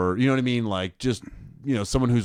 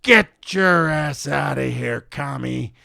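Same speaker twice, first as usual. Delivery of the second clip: slow, hammed-up old woman threatening; old lady yelling; 5 semitones higher, 4 dB louder, slower than usual. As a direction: drag it out like a batty old lady. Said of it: "She's like".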